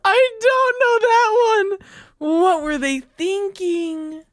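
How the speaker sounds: high mock wail. High crying-like outburst.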